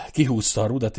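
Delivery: ordinary delivery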